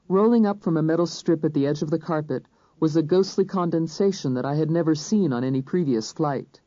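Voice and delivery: ordinary delivery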